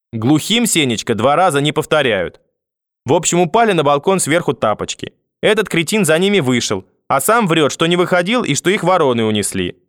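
high-pitched, testy retelling; grandmother complaining, irritated. A narrator's reading. Grandmother retelling it all to grandfather, irritated complaint.

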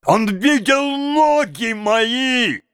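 high, slow, declaimed outcry; impassioned shouting. A narrator's reading. Mendel rages about the yard that saw his life, impassioned shouting. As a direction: shout each phrase out in a frenzy. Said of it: "закричал он изо всех сил".

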